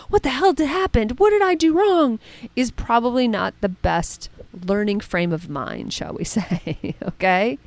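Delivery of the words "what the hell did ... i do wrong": rising, higher panicky questions; panicked self-questioning. Retold or imitated. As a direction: freak out at yourself, pitch climbing. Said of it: "flipping on the video and going".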